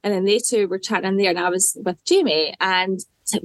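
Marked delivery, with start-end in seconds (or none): none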